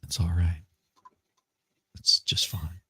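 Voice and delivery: breathy, low and voiceless; sultry whisper. Acted. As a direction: purr it in a whisper. Phone sex voice game.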